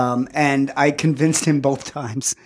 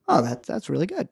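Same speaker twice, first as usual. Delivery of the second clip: soft, higher, mildly surprised approval; placated dad; 4 semitones higher, 8 dB quieter than usual. Retold or imitated. Dad hearing son's writer ambitions, softly placated. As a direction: back off gently, lightly imitating a dad. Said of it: "And he goes".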